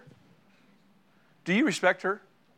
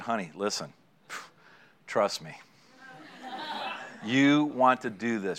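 low, soft coaxing; coaxing husband; 5 semitones lower, 5 dB quieter than usual. Retold or imitated. Husband coaxing his wife to invest, softly. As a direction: sweet-talk her into it, low and soft.

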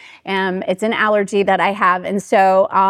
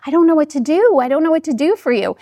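imitating a flustered baker, pitched higher; retold exasperation, raised in pitch; mildly frustrated; 5 semitones higher, faster than usual. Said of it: "this kind of frustrated her".

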